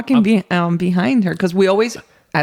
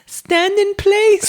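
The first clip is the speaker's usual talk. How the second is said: high, drawn-out call to someone panicking; 12 semitones higher, 4 dB louder, much slower than usual. Retold or imitated. Parents to screaming child with bird in hair, calling out. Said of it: "And we kept saying".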